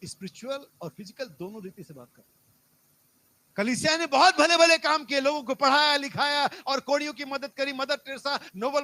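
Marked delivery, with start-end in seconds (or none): 3.6-8.8 loud, driving sermon list; full-force preaching. Real, not acted. Preacher lists the church's good works, loud preaching. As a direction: pile up the good deeds emphatically, opening loud.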